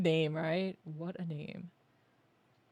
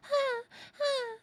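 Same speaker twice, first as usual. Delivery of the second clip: high breathy giggle. Real, not acted. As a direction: burst into a giggle. Laughs at the running.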